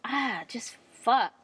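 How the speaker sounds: usual speaking voice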